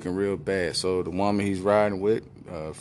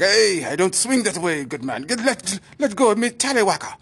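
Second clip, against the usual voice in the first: higher, louder Irish protest; mock protest; 11 semitones higher, 5 dB louder than usual. [name] fighting off the doctor, loud high protest. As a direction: push him off indignantly.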